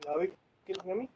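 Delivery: very faint, fast voice; faint and far from the mic; barely audible. Real, not acted. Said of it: "your voice is very low".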